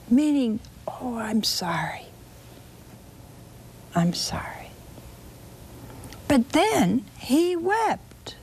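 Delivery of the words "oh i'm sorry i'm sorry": hushed, half-whispered condolence; softly acted sympathetic sigh; sighed sympathy. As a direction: voice a heartfelt sigh in soft words. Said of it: "a sigh that came straight from the heart".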